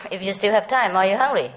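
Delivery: ordinary delivery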